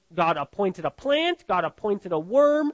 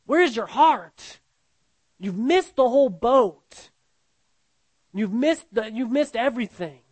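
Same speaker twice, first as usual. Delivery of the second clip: higher and louder scolding; raised rebuke; 3 semitones higher, 3 dB louder than usual. Acted. God telling Jonah he missed it, raised scolding. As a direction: scold him, pressing hard.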